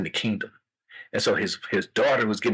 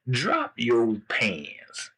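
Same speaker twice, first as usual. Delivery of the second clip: very slow, drawn-out command; slow royal order; king; much slower than usual. Acted. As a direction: stretch each word as a commanding king. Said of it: "King said".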